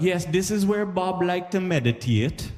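high, slow tour guide voice; mock tour guide. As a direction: play a solemn Jamaican tour guide. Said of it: "So they'll be like".

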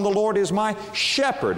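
high throughout, "shepherd" set off and weighted; stress on SHEPHERD. Shown as a demonstration. Preacher stressing the shepherd's care, stress on "shepherd". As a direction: build up to the word "shepherd". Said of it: "put the emphasis upon".

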